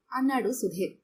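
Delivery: plain reading voice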